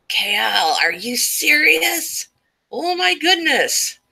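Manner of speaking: high, drawn-out gasping disbelief; real surprise, pitch climbing high; shocked disbelief. Real, not acted. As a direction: react like the chat just floored you. Shocked reaction to chat suggestion.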